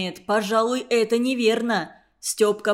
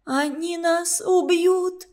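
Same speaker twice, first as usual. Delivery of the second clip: высоко, медленно, с ужасом; выдавить в ужасе; в панике; 7 semitones higher, slower than usual. Acted. Люська в панике. (испуганный вскрик)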